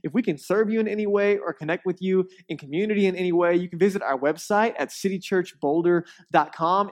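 loud, upbeat podcast welcome; host's intro read. Acted. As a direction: address listeners with polished, projected energy.

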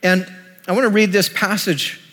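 normal talking voice

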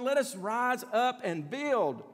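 slow, lifted, rousing call; rallying cry. Retold or imitated. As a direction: sound the rally, drawn out and eager. Preacher retells the people's rallying cry.

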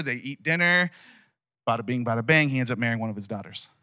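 usual speaking voice

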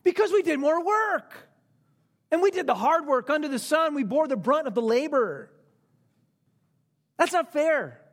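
high-pitched, hammed-up protest; indignant complaint. Workers who bore the brunt complaining, acted indignation. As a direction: plead your case as the wronged party. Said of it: "what their great complaint was".

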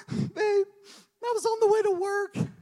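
high-pitched, hammed-up tearful wail; mock crying wife. Wife phoning husband on her way to work, mock crying. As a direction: imitate your wife crying in squeaky falsetto. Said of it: "'Cause that's how Marissa's cries".

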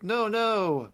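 high-pitched imitated objection; mock protest. Tatiana stepping in for her helpers, mock protest. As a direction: pitch it up like a child interceding. Girl pleads against orc chief's threat.

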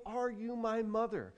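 high, lightly acted plea; a hint of a searching baby bird. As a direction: ask like a lost little chick. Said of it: "things that can't possibly be his mother saying".

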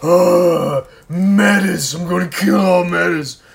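loud, hammed-up villain roar; overacted villain. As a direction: ham it up like a scenery-chewing baddie. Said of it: "the most ridiculously overacted villain".

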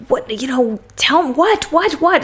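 high-pitched, repeated demand; exasperated challenge. Retold or imitated. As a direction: imitate impatient, frustrated questioning. Retold confrontation, she challenges him.

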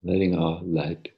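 soft, unhurried meditation voice; soft spa whisper. Acted. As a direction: ease them gently into stillness. Leads a guided meditation.

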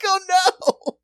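loud, high mock cry of alarm; mock fright. Dad reacting to his daughter's curse with a loud mock cry. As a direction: squeal as if cursed.

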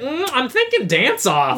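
high-pitched, drawn-out pondering; playful administrator. Acted. Administrator announcing her verdict to Taylor, high and playfully drawn out. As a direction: act a hammed-up administrator musing aloud.

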